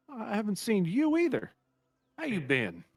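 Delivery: put-on old innkeeper welcoming a returning guest. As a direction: greet an old friend cheerfully.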